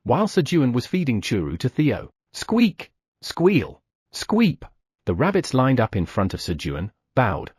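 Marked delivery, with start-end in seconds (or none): 2.3-4.6 high, far-off rabbit squeaks; a chorus of little rabbit squeaks. Acted. Rabbits lining up.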